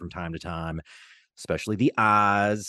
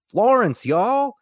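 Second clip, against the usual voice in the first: loud, high-pitched announcement of a landmark; big, high, showy call-out; proud proclamation; 8 dB louder, much slower than usual. Acted. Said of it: "proudly proclaim".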